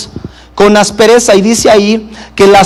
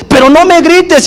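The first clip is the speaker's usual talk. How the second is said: raised, higher pitched protest in a mock argument; mock shouting back; 8 semitones higher than usual. Partner in a heated argument protesting, higher, mock yell. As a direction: play the offended partner yelling back. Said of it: "empieza a subir el tono de la voz".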